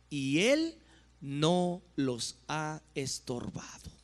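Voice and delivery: slow, deliberate prophetic reading; solemn scripture declamation. Acted. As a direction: weigh out each phrase of the judgment, slowly. Proclaims scripture slowly. (lectura profética solemne y pausada)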